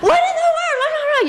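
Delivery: very high-pitched mock yell; retold yelling. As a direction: imitate yourself screeching at God, half laughing. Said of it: "I just started yelling".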